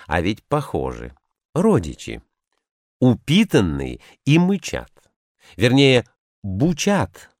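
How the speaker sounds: ordinary delivery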